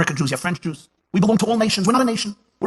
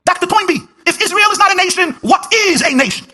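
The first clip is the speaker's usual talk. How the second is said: high, loud preacher's cry; preaching at full force; 7 dB louder, slower than usual. Acted. Herzog presses Toynbee before the audience, fiery preaching. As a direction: drive the point home to the crowd.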